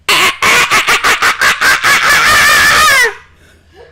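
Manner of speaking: imitating a contestant's dolphin call; mock dolphin sound. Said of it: "He does the same fucking sound effect".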